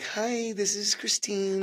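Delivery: chirpy receptionist answering a call; customer service voice. Retold or imitated. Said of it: "you have a customer service voice".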